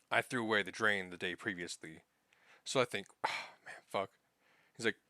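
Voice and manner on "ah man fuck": whispered curse. Retold or imitated. Thinking in dismay.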